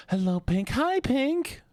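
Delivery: very high, bright hello; squeaky high greeting. Acted.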